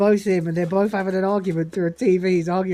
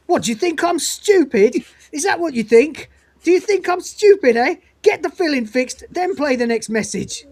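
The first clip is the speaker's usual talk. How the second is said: high, pressed, acted telling-off; indignant scolding; 7 semitones higher, 4 dB louder than usual. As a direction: berate him like an exasperated dad. Retold father figure berating Lister.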